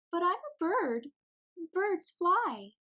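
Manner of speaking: put-on high, insistent child voice; protesting little penguin. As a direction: plead your case like a small chick. Pip protests to Mama.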